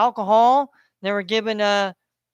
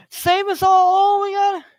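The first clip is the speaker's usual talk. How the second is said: mock panicked plea; 11 semitones higher, 6 dB louder than usual. Mocking people who line up to obey, high wailing plea. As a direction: wail it like a helpless crowd. Mocks people obeying for their safety.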